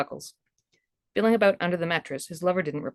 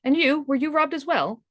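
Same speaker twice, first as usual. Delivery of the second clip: put-on higher, concerned young man; Ralph's voice; 8 semitones higher than usual. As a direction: ask with worry, voiced up. Ralph asks lover worriedly.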